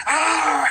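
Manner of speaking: sudden high yelp of overwhelm, acted out; frustrated yelp. Singer to interviewer, acting out late-night overwhelm with a high yelp. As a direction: toss out a loud mock cry of exasperation. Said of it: "Some nights I'm like".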